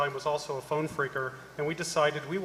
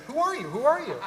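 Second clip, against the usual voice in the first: higher, louder, bewildered asking; mock alarmed questioning; 10 semitones higher, 4 dB louder than usual. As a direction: retell her confused demand in a high voice. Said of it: "she's saying".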